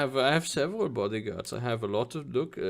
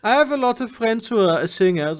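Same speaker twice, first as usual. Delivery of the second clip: raised voice over loud music; louder repeat; 9 semitones higher, 6 dB louder than usual. Acted. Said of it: "one more time, can you say that?".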